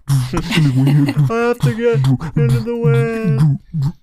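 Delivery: slow, loud hammed-up dub line; overacted anime dub. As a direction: drag it out like a cheesy anime dub. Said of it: "the voice acting".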